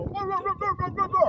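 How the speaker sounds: mock the coach like jeering fans. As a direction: home crowd taunting the rival coach, high mocking chant. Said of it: "And our fans would go".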